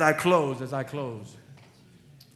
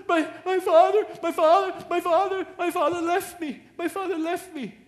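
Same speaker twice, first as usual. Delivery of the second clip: put-on high, loud wailing girl's voice, half laughing; sobbing teen girl; 8 dB louder than usual. Pastor voices a sobbing girl whose father left her. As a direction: voice a heartbroken teenage girl crying out. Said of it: "Mija, what happened?".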